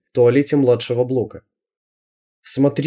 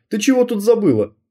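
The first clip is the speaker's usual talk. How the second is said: sharply higher surprised question; surprised teacher; 10 semitones higher than usual. A narrator's reading. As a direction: catch her off guard, high. Teacher questions intruding girl.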